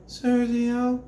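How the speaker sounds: high, thin Sergio from the throat; weak throaty try. Shown as a demonstration. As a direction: say it wrong, from the throat.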